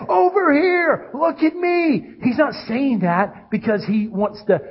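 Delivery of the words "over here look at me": high, drawn-out shout for attention; calling out. Acted. God wanting his people's attention, calling out. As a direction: call slowly as if across a crowd.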